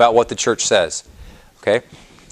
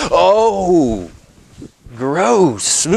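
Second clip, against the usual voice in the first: mock disgust; 4 semitones higher than usual. Mocking a disgusted reaction.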